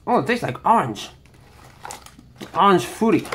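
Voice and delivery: ordinary delivery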